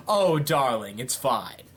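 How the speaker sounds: lightly acted suave villain, dropping low; lightly voiced Scar. Scar forgiving Cruella, lightly voiced and low. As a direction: soothe it away, pitch sinking at the end. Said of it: "he's just still like".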